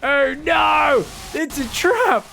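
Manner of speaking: hammed-up high, loud alarm; mock panicked shout. Caster voicing the fleeing Zerg player, mock panicked shout. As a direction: cry it out like a doomed movie hero. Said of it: "He's like".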